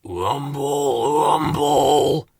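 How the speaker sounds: rolled, drawn-out rumbling noise; growly imitation of a hungry tummy; tummy rumble. A narrator's reading. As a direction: voice a rumbling stomach. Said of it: "went Slow Coach's tummy".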